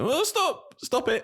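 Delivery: very high, squeaky protest; retold alarmed plea. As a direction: plead in a comically high voice. Retold plea to scolding teacher.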